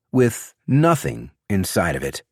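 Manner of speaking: usual speaking voice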